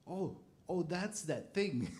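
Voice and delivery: high, slow delighted discovery; excited realization. Retold or imitated. Retold moment of realization.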